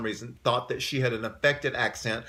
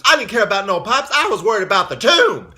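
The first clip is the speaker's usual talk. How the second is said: higher, louder mock diva retort; imitating diva; 8 semitones higher, 9 dB louder than usual. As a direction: toss it off like an unbothered diva. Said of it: "She says, she says".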